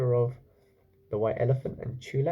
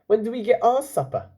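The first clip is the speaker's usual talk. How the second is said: high, loud indignant waiter; complaining waiter; 10 semitones higher, 6 dB louder than usual. Acted. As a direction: protest like a hungry waiter.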